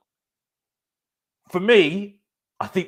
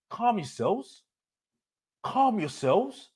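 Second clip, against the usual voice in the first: sarcastic calm down; 7 semitones higher, 4 dB quieter, slower than usual. Real, not acted. Telling gushing fans to calm down.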